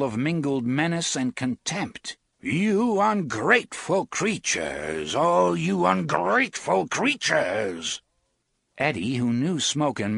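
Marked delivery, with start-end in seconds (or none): 2.4-8.0 contemptuous snarl. A narrator's reading. The smoke face snarls contempt at the travelers. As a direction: spit the insult out slowly.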